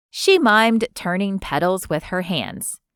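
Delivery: usual speaking voice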